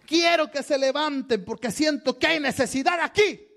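high-pitched imitation of a fervent preacher; preacher at altar call. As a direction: call the congregation up like an evangelist. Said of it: "donde el predicador dice".

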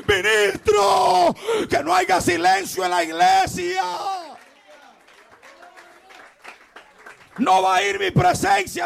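high, loud exhortation; fiery preaching. Acted. Preacher exhorting pastors not to keep silent, fiery shouting. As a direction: call out the preachers with force.

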